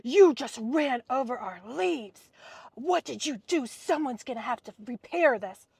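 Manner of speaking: husband accusing the driver of ruining the leaves, loud and nasty; nastier husband. Retold or imitated. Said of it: "even nastier than this".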